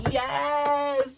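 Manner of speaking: high, long-drawn cheer; retold triumph. Mom as her son takes off, triumphant. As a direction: stretch it out in relief. Celebrates that he got off.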